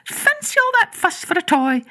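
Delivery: high, brisk nanny voice; a nurse brushing off the fuss; Nana scoffing. Acted. Nurse scoffing at the boy's fuss over a toy, high and brisk. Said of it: "she said".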